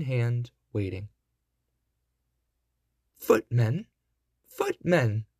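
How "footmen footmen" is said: mildly impatient godmother calling out; a touch of impatience in a storybook summons; impatient cry. A narrator's reading. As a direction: call twice, first rising, then falling. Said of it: "cried the godmother impatiently".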